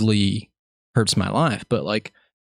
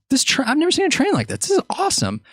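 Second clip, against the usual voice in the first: very high, fast excited squeal; thrilled kid voice; childlike awe. Retold or imitated. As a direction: gush over the train. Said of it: "I was like".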